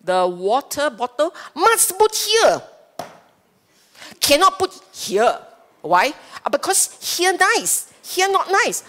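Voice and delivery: high, put-on fussy voice, mocking someone's fussiness; fussy particular person. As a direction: act out a finicky person fussing over where things go. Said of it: "you're very particular".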